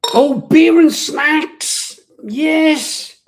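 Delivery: high, loud, drawn-out cheer; excited announcement. Acted. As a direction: sing out the segment name with glee. Cheery call-out of show segment.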